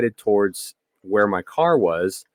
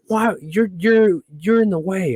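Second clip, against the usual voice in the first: high, snippy imitation of the lady; high mocking Karen scold; 9 semitones higher than usual. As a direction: mimic the scolding driver mockingly. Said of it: "starts screaming at me".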